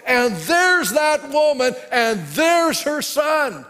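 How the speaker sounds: loud, high, slow and stretched, pointing her out; calling out with big excitement; excited shout. Retold or imitated. Gehazi spots the woman across the court, excited shout.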